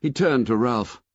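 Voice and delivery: ordinary delivery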